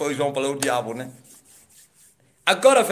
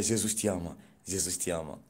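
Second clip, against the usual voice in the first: much softer, lower voice fading out; a distant voice in his head; quiet inner voice; 10 semitones lower, 10 dB quieter than usual. Retold or imitated. Voice in his mind repeating to him, softly fading. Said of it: "E a voz dizia".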